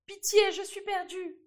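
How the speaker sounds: king crying out in terror as the mouse bites; terrified cry. A narrator's reading. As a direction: wail for mercy as the mouse gnaws him. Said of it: "Il cria".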